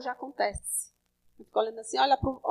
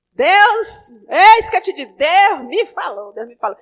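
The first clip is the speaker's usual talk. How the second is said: mock prophet proclaiming; 9 dB louder than usual. Preacher mocking a showy prophet to the congregation, loud proclamation. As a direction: ham up an overbearing self-appointed prophet. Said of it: "tem gente que diz logo assim".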